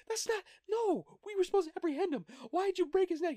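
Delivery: squeaky, flustered objection; high-pitched protest. Retold or imitated. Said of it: "He goes".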